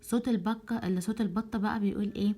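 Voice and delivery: normal talking voice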